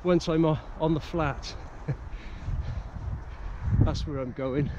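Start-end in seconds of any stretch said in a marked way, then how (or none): 1.6-3.8 quiet, breathy laugh with little voice; low-key airy laugh; soft chuckle. Real, not acted. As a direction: chuckle softly to yourself. Chuckles after describing the climb.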